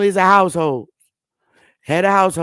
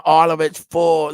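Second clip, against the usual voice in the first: normal talking voice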